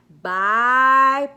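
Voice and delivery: normal talking voice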